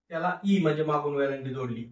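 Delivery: usual speaking voice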